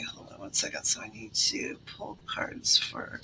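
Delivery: soft unvoiced whisper aside. Real, not acted. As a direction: drop to a whisper, muttering to self. Aside while pulling cards.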